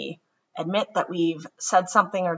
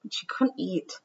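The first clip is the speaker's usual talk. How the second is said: gentle storybook read-aloud; children's story narration; 2 semitones higher than usual. A narrator's reading. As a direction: read it like bedtime story time. Reads picture book aloud.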